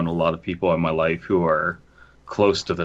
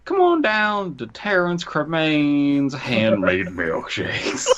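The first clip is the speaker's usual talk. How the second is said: much higher, slow, hammed-up salesman call; mock ad pitch; 6 semitones higher, slower than usual. Spoof ad for Terrence's milkshake stand, hammed-up pitchman. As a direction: sell it like a cheesy local commercial.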